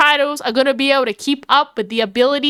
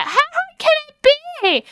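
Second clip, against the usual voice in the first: pitched way up, breezy and overconfident; cocky overconfidence; 13 semitones higher than usual. Retold or imitated. Aries mocking her past self, high and cocky. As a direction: toss it off like nothing could go wrong. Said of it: "So Aries was all like".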